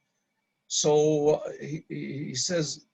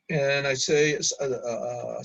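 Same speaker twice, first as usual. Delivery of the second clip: plain reading voice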